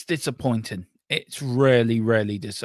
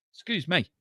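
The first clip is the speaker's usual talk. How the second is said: after a cough; 6 semitones higher, 4 dB quieter than usual. Real, not acted. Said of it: "Pardon me".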